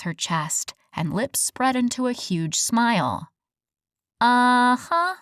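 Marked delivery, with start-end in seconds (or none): 4.2-5.2 stretched-out knowing uh-huh, louder; drawled. A narrator's reading. Evie doubting the nightmare story, knowing drawl. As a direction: drag it out, not buying it. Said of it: "she drawled".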